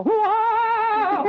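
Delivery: sudden high loud whoop; crazy whoop. Acted. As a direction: let out a wild lunatic holler. Cured lunatic demonstrates his outburst.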